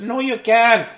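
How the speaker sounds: high, loud grandma refusal; scolding grandma. Acted. Grandma forbidding play in clean sheets, scolding.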